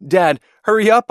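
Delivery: high, loud call to hurry; excited urging. A narrator's reading. Boy hurries his dad to the airplane race, excited urging. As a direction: rush him along eagerly.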